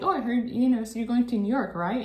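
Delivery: high, loud curious questions; eager questioning. Retold or imitated. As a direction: fire off nosy questions. Retelling what he asked.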